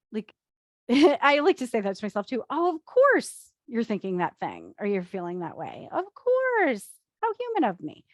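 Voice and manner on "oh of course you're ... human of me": mock self-talk. Shown as a demonstration. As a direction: playfully reassure yourself, pitching up on the last words. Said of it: "that's my little humor I put in there".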